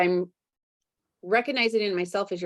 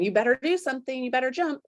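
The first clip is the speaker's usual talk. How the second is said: higher, quick, urgent push; scary inner voice; 6 semitones higher, much faster than usual. Retold or imitated. Scary inner voice pushing her to act, higher and quick. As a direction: hurry yourself along. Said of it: "that scary voice going".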